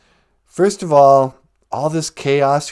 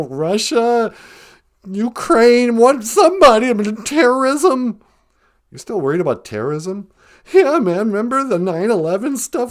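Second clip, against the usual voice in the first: higher anxious caller voice, frantic; Cowardly Lion caller; 3 semitones higher than usual. Acted. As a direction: play a jittery, panicky lion calling in. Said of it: "it's the Cowardly Lion".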